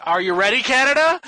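rousing commentator call, climbing high and loud; BDM. Retold or imitated. Commentator over the coverage to Canada, rousing. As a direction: imitate a hype announcer, building to a shout. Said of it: "you hear B D M over the coverage".

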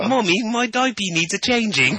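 high-pitched pretend baby with a British accent. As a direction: pipe up like a very proper British infant. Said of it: "you had a British accent".

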